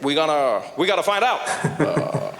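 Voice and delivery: halting presidential address with emphatic bursts; hesitate, then declare it like a statesman; Barack Obama impression. Comic plays president vowing action, halting statesman impression. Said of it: "Barack Obama, he was there, he's like".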